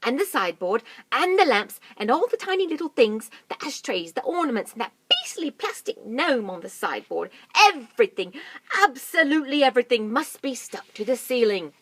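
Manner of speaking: loud, high rattled-off list; loud urgent list. Acted. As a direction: fire off every item. Mugglewump listing.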